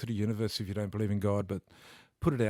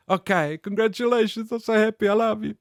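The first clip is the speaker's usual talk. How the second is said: put-on much higher, louder Italian-accented mum voice; 12 semitones higher, 7 dB louder than usual. Mum calling from Italy to congratulate him, in an Italian accent. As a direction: gush like an excited Italian mother on the phone. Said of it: "In Italian, of course. That's why they put the accent on".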